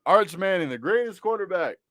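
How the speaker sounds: sarcastic praise, barely holding it together; tongue-in-cheek tribute; mock praise. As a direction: say it like a joke you can't keep straight. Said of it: "I can't even say it with a straight face".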